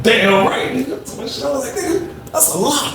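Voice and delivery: hoog, lachend en opgewonden; laughing. Retold or imitated. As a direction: praat door het lachen heen. Excited laughing reply.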